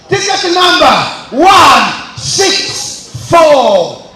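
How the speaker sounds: raffle caller. Real, not acted. MC calling raffle winner.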